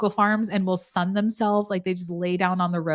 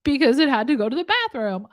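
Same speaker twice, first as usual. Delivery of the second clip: put-on kid's punchline; 6 semitones higher, 3 dB louder than usual. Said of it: "all of the jokes are that".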